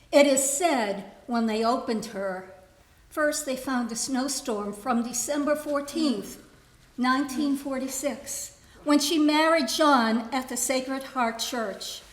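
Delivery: deliberate recital, phrase by phrase; poetry reading. Acted. Poet reading a poem written for her mother, deliberate recital. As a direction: recite it line by line, setting out each keepsake.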